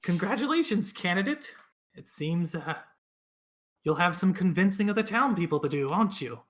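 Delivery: put-on teasing elderly woman; old lady voice. Old lady congratulates Dell.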